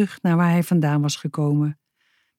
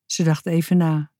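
plain reading voice

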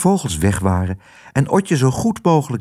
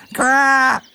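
hoge, doordringende kraaienkreet; roepende kraai; 13 semitones higher than usual. A narrator's reading. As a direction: kras boven het gekwetter uit. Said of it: "riep Kwark boven alles uit". (hoge kraaienroep)

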